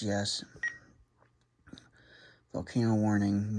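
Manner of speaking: ordinary delivery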